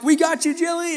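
put-on high-pitched excited kids' encouragement; lightly acted eager teens; eager rallying. Eighth graders rally round Jilly.

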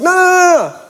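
high, rapid-fire no's; comic high-pitched balking; mock panicked refusal. Acting his refusal to the kids.

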